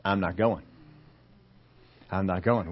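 low, soft, sulky-sounding no; subdued refusal. Acted. Hurt churchgoer refusing an invitation, low and subdued. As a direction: refuse under the voice. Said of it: "and what do they say".

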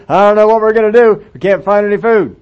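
loud frantic worry; panicked brother. Acted. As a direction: brother losing his nerve over no food.

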